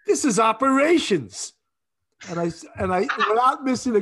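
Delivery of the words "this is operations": high, loud, drawn-out phone greeting; condescending. Retold or imitated. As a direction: imitate a haughty old battle-axe answering. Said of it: "very, really condescendingly".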